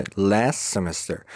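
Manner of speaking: "Last semester" as "las-semester"; silent T. Shown as a demonstration. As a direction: slowly drop the t of "Last". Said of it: "اینجا تی تلفظ نمیشه". («Last semester» بدون تی)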